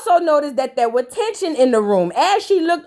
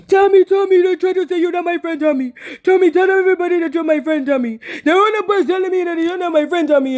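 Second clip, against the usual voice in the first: whiny plea; 4 semitones higher, faster than usual. Retold or imitated.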